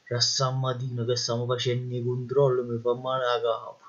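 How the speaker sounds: weary Neapolitan marshal. Acted.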